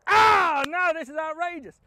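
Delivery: high, loud cry of outrage; outraged yell. Retold or imitated. Taxman yelling in outrage at the second eyeball. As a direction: bellow it in disbelief.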